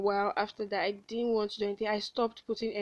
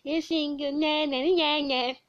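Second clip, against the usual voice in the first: put-on sing-song childish teasing, pitched higher; mocking taunt; 5 semitones higher than usual. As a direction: taunt like a schoolyard bully. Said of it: "a lot of people pointed it out".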